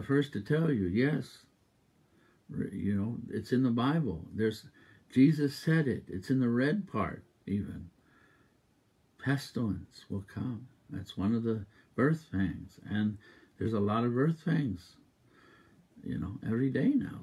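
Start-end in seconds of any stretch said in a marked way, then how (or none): none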